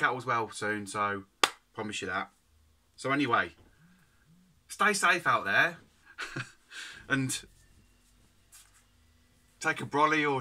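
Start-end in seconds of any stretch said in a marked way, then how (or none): 4.7-5.8 emphatic farewell. Real, not acted. Sign-off to viewers.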